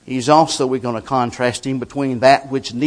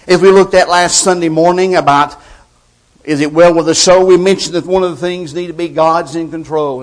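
loud, driving preacher's cadence; full-force preaching; 5 semitones higher, 12 dB louder than usual. Acted. Preacher to his congregation, booming sermon delivery.

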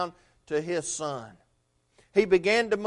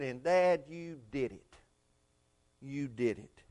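put-on much softer, lower, tender child's thanks; whisper-soft awe at the rescue; trapped son thanking his dad; hushed gratitude; 5 semitones lower, 13 dB quieter than usual. Rescued son tells father.

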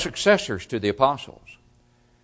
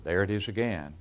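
ordinary delivery

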